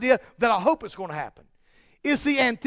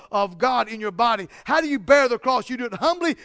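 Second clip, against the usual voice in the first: usual speaking voice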